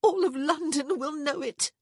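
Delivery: high, loud, frantic outburst; alarmed, emphatic insistence. A narrator's reading. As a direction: cut in with rising panic. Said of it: "she frantically said again".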